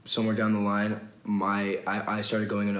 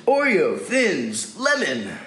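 mock ad read; 5 semitones higher, 5 dB louder, much slower than usual.

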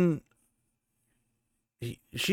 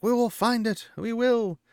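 higher, firm voice for Elaine; Elaine reassuring; 10 semitones higher, 3 dB louder than usual. Retold or imitated. Elaine promising Rand they will find a way, pitched-up voice. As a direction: promise it like a young queen, pitched up.

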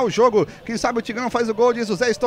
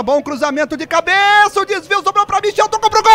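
building goal call; 10 semitones higher, 5 dB louder than usual. Real, not acted. Commentator calling the cross as it becomes a goal, building excitement. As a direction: pitch climbing into excited commentary.

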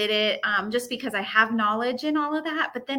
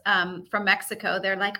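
normal talking voice

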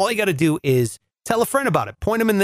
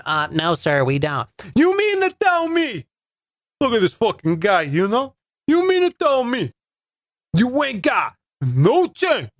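indignant mock Sopranos guy, pitch jumping high; Sopranos accent; 4 semitones higher than usual. Customer refused for lack of change, Sopranos accent. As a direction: complain like a hammed-up Sopranos mobster. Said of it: "that's my Sopranos accent".

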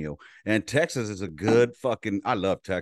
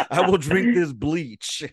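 slow, higher, louder hammed-up threat; hammed-up imitation of a desperate trainee's slow, high, loud threat; mock melodramatic threat; 7 semitones higher, 5 dB louder, slower than usual. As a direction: drag it out like a dramatic teen threatening the worst. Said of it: "They were to the point of".

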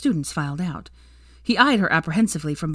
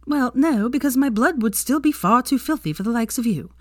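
exasperated; 6 semitones higher than usual. A narrator's reading. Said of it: "she said exasperatedly".

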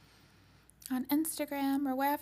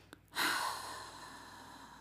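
unvoiced breathy exhale; slow sighing out; long release sigh; 4 dB quieter than usual. Releasing breath in healing session.

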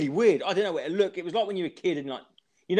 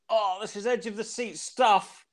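mock commentator; 7 semitones higher, 3 dB quieter than usual. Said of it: "he was going".

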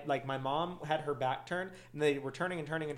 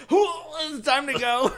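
mock inner voice; 10 semitones higher, 9 dB louder, much slower than usual. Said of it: "It's like".

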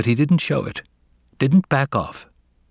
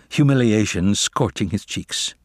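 ordinary delivery, nothing marked

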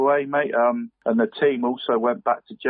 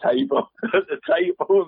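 laughing; 7 semitones higher than usual. Real, not acted. Laughing while retelling mum's tears.

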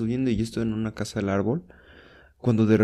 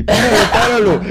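high loud plea broken by laughter; laughing plea; 10 semitones higher, 9 dB louder than usual. Retold or imitated. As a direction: beg to stop while cracking up.

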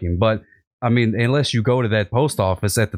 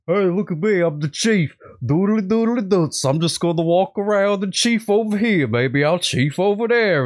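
much higher, goofy strutting boss voice, slowing at the end; mocking the chief; 9 semitones higher, slower than usual. Cop mocks his chief when the chief makes him mad, high goofy voice. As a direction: lampoon the pompous boss. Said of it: "I do an impression".

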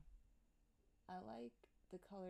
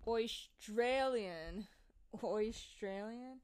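very slow, exaggerated Australian accent; attempted Australian accent; 2 semitones higher, 7 dB louder, much slower than usual. Shown as a demonstration. Host practicing the word, exaggerated Australian accent. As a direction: stretch out "Australian" in a hammed-up Australian accent. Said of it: "I wish I had an Australian accent".

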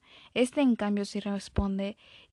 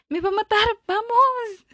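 much higher, louder panicked shout; panicked cry; 12 semitones higher, 9 dB louder than usual. Acted. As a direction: yell it while running off in fear. Chica corriendo, en pánico. (grito de pánico, agudo y fuerte)

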